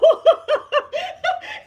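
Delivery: very high, loud burst of laughter; shrieking real laughter; hard laugh. Real, not acted. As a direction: crack up at your own creepy story. Laughs at creepy story.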